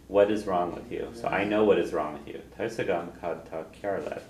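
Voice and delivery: usual speaking voice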